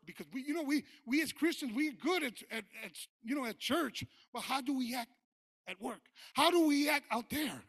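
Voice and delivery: loud, high preacher's cry, slowing to a shout; rising sermon shout; fiery preaching. Acted. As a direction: preach it out to the congregation at full force. Preaching to the congregation.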